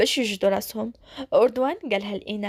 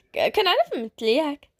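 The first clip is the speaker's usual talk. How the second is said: usual speaking voice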